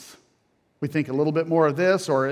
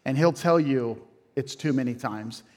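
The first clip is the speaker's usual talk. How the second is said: ordinary talk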